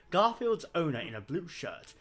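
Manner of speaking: ordinary talk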